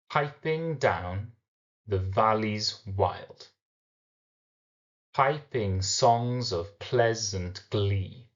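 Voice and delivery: slow reading with PIPING leaned on at the first syllable; measured verse reading, stressed beats drawn out in "piping songs of pleasant glee"; metrical reading. Shown as a demonstration. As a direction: read "piping down the valleys wild" slowly, beat by beat. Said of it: "as we read this first couple of lines".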